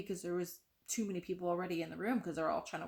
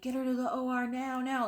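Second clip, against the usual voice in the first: higher, mildly mock yell; retold urgent yell; 5 semitones higher, 5 dB louder than usual. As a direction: imitate nurses yelling out an urgent order. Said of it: "like, yelling and screaming".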